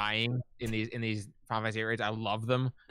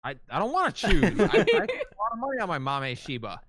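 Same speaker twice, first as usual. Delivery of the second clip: louder, higher whining protest; higher, louder playful whine; whiny protest; 8 semitones higher, 7 dB louder than usual. Real, not acted. Protesting having to choose.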